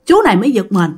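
usual speaking voice

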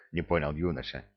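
ordinary delivery, nothing marked

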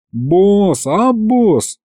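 plaintive. A narrator's reading. Demon appeals to his boss, plaintive. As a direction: whine it mildly, pleading. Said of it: "как-то тоскливо протянул бес".

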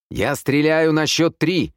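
loud, high, drawn-out warning yell; threatening shout. A narrator's reading. Man yelling a warning from the corridor, shouting. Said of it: "крикнул тот же голос из коридора".

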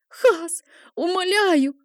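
high, desperate begging cry. A narrator's reading. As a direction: beg for your life. Begging while dragged away.

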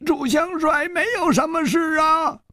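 put-on high, creaky old elder voice; old man's voice. A narrator's reading. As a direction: speak as an aged beggar elder. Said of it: "那个苍老的声音说". (苍老的声音)

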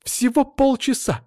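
very high, drawn-out protest; high-pitched schoolgirl indignation; indignant. A narrator's reading. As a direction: squeak it in outrage. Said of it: "возмутилась Анжела".

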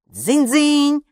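high, loud imitated ringing; demanding doorbell. A narrator's reading. Doorbell suddenly rings, loud high demanding ding. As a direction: ring out sharply like an insistent doorbell. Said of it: "громко и требовательно зазвонил звонок".

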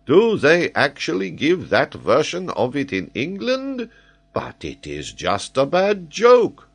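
scandalised, emphatic outburst; incredulous protest. Acted. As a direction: protest as if personally affronted. Geographer outraged at English story.

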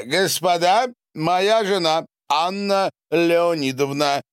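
high, loud announcing voice; loud introduction. A narrator's reading. Husband presents his wife to the bathers, announcing loudly. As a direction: announce her grandly to the room.

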